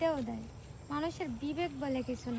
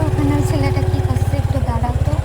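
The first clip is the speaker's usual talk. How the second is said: yelling across the room; 15 dB louder than usual. Real, not acted. Pointing out boy across area.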